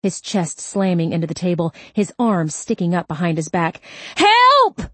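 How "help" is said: very high, very loud scream for help. Acted. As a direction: scream it at the top of the lungs. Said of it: "he screamed".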